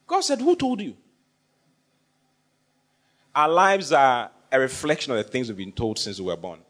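ordinary delivery

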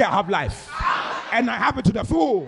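emphatic declaration. Shown as a demonstration. Preacher tells congregation to say it, in emphatic declaration. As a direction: declare it boldly, lifting the second phrase. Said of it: "Ah, say".

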